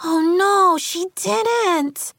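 outraged. A narrator's reading. Athena hearing how Karen was abandoned, outraged. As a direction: burst out in scandalised outrage. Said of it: "Athena was quaking with rage".

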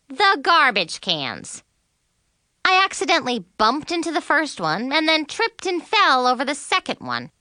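ordinary delivery, nothing marked

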